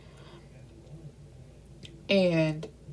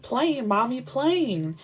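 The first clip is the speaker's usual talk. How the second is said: put-on higher, sing-out toddler voice; cute little child; 6 semitones higher, slower than usual. Little Rain showing mommy the plane, cute child voice. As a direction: imitate a small child pointing something out. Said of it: "little cute self".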